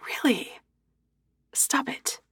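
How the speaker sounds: embarrassed whispered protest. Acted. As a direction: hiss it out under your breath.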